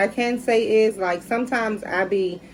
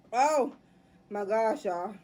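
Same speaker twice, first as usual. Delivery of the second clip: normal talking voice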